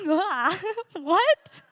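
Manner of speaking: rising into a squeak of wonder; amazed disbelief. Retold or imitated. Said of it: "And I was like".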